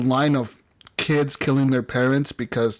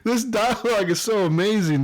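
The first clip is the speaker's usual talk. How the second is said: excited; 7 semitones higher than usual. Retold or imitated. Retelling own excited reaction.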